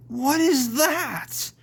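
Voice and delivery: high, drawn-out alarm; alarmed cry. Acted. Edward sees a big saw coming, alarmed. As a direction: cry out at the horror.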